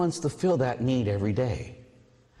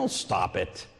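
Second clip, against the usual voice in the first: dismissive reassurance; 6 semitones lower, 3 dB quieter, slower than usual. Retold or imitated. Priest brushing off worry.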